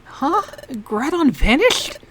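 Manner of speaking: ordinary talk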